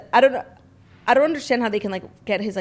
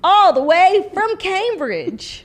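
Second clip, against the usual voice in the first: slow, higher hammed-up Southern twang; mock American drawl; 10 semitones higher, 7 dB louder, much slower than usual. Harry boasting about Montecito, slow mock Southern twang. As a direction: stretch it out like a cowboy.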